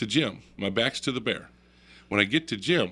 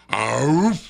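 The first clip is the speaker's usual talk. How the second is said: loud, gruff bear huff; sudden loud animal bark, acted out; imitated bear woof; 4 semitones higher, 7 dB louder than usual. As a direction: mimic a bear's warning woof. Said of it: "lifts up his right paw and goes".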